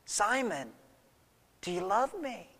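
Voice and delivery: soft, searching question with pauses; gentle question. Acted. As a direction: ask it quietly, leaving space after the name. Acted Jesus gently asking again.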